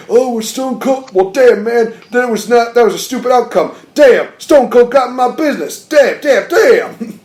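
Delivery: mock Farouk whining that Stone Cold interfered; pissy moaning. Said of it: "all pissy and moaning".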